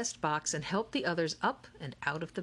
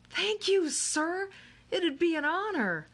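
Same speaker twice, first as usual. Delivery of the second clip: put-on high, eager boy's voice; Miguel's eager voice; 12 semitones higher, 4 dB louder than usual. Miguel to Mister Lemoncello, high eager thanks. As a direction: thank him, bright and thrilled.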